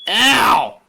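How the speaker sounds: pained yelps. Real, not acted. Reacts to the fire alarm noise.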